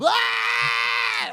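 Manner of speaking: long imitated power-up yell; hammed-up anime battle scream; mock scream like charging up an attack; Dragon Ball charge-up scream. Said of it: "like D B Z charging up".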